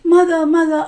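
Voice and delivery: put-on girl in bed calling loudly to her mother for help. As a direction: call out to mother in distress. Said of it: "And she said".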